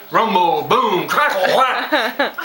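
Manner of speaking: loud spoken thunder effects, rising to a high whack; mock thunder noises. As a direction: boom it out like a sound-effects man. Mock thunder crash after joke.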